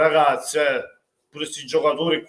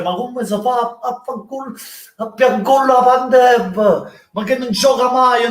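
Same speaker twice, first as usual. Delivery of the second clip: indignant rant; 6 semitones higher than usual. Real, not acted. Fan raging that a benched veteran scored, shouting. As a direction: rant that a pensioner is scoring on you. Fan ranting about old player.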